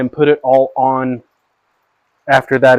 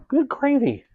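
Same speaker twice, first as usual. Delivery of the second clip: exasperated exclamation; 12 semitones higher, 4 dB quieter than usual. Real, not acted. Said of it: "can't even keep my glasses on".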